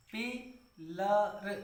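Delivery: पिलर said very slowly, stretching the vowel; drawn-out pillar. Shown as a demonstration. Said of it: "आ का उच्चारण है पिलर". (पिलर को धीरे खींचकर बोलें)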